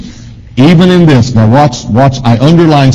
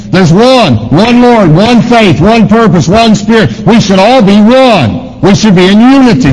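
preaching at full force; 9 semitones higher than usual. Real, not acted. Preacher rising to climax.

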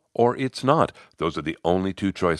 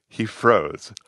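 ordinary delivery, nothing marked

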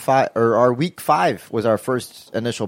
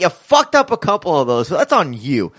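heated rant; 6 semitones higher than usual. Real, not acted. To the Patriots, a heated, rising rant.

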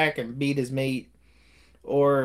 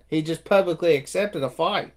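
ordinary talk, nothing marked

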